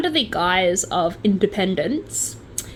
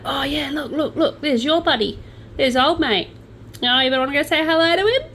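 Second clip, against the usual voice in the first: raised-pitch mock-eager ribbing; teasing; 5 semitones higher than usual. Friend spotting someone the speaker dislikes, teasing. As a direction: play the friend winding someone up.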